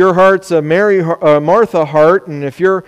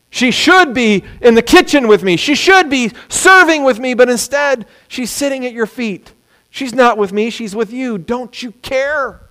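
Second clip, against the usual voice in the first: bitter complaining Martha; 7 semitones higher than usual. Acted. Martha complaining to Jesus about her sister, high aggrieved whine. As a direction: sound hurt and left out by your sister.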